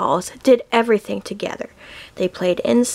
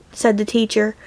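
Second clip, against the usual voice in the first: plain reading voice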